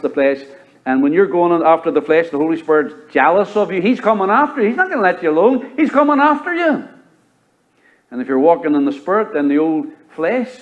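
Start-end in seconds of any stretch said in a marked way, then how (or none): 3.8-5.7 higher, insistent warning; preaching emphasis. Acted. Spirit pursuing the sinner. 5.8-6.9 much higher, urgent climax; peak preaching cry. Acted. Spirit pursuing the sinner.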